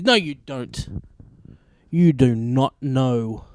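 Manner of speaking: slow, deliberate, every word weighted; firm rebuttal. Acted. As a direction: shut him down slowly. Rebutting the imagined know-it-all.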